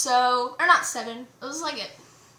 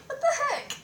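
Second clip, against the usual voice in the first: high-pitched, laughing mock bafflement; baffled disbelief; 12 semitones higher, 3 dB quieter than usual. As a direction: squeak it out, amused and incredulous. Retold baffled reaction to touch.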